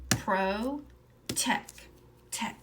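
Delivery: "Pro, tech" said apart, the syllable repeated; stretching sounds. Shown as a demonstration. Teacher sounding out the second syllable for listening. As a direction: segment "tech" slowly.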